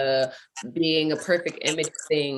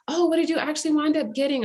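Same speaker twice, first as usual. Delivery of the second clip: higher, quick, eager question; acted chatty curiosity, pitched up; curious stranger; 9 semitones higher, faster than usual. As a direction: imitate a friendly stranger checking in. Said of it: "she was just like".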